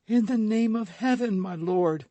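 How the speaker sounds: very high, loud plea; frantic cry. A narrator's reading. Madame Bonacieux stopping the duel, high frantic cry. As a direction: throw yourself between them, crying out. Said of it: "cried Madame Bonacieux".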